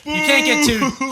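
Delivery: loud, high objection; indignant protest. Real, not acted. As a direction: shout the complaint. Protesting the rule loudly.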